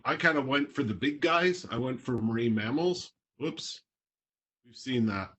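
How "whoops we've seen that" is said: normal talking voice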